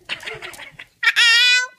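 high, loud burst of laughter. Real, not acted. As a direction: laugh out brightly. Laughing after barking.

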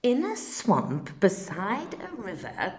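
plain reading voice

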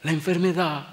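high, anxious woman's voice; an alarmed lady warning the pastor; woman pleading. Retold or imitated. Lady urgently warns pastor away from sick son, high pleading.

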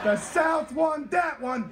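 high, slow shouted verdict; big drawn-out triumphant announcement; victory announcement to crowd. Real, not acted. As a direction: call the winner out to the whole room. Declaring winner of crowd cheer contest.

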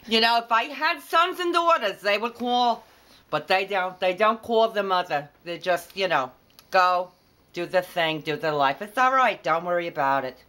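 high-pitched Brooklyn mother, mock aggrieved; Brooklyn accent. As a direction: play a put-upon Brooklyn mother. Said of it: "do a Brooklyn accent with that".

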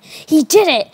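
mock boom in a storyteller's voice; booming outburst. A narrator's reading. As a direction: raise the pitch for an outburst. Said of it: "said in a booming voice".

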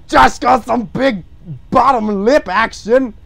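loud, high, slow exclamation; drawn-out mock amazement; loud hollering. Real, not acted. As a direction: holler it, stretching each word. Hollering commentary on the drawing.

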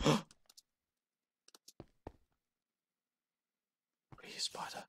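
barely audible whisper; hushed whisper. Real, not acted. Gamer hunting for a spider, whispering. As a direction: whisper it, very softly.

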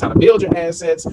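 ordinary talk, nothing marked

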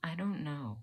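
soft, slightly high, subdued admission; quiet uncertainty. Acted. Student wondering if it's too much, quietly lost. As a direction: admit it softly, at a loss. Quiet repeat after outburst.

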